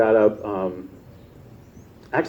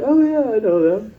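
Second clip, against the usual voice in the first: put-on friendly young man; 11 semitones higher than usual. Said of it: "he was like".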